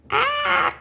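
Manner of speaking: very high, loud scream; frightened bird shrieking. Acted. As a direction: shriek in terror. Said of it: "shrieked Flip".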